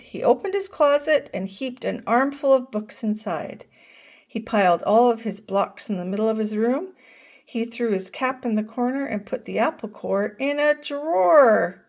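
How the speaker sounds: usual speaking voice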